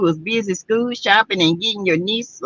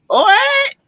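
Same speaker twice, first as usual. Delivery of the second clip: high, startled what; a real high gasp of surprise; surprised what; 11 semitones higher, slower than usual. Real, not acted. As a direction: shoot up in shock at the news. Hears about a neighborhood brawl.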